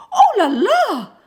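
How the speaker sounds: very high, loud panicked oh la la; frightened cry. A narrator's reading. Old lady meets the lion and cries out in alarm. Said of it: "cried Madame Pinson".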